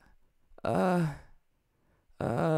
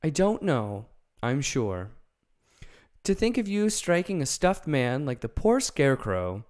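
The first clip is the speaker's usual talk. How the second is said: higher, louder reproach; Dorothy reproaching the lion; indignant Dorothy; 5 semitones higher, 5 dB louder than usual. Acted. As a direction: shame him for hitting the Scarecrow.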